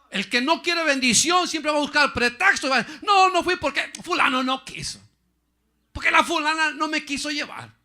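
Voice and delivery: high, loud mocking pulpit shout; preaching at full force; shouting preacher. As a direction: hammer the point home.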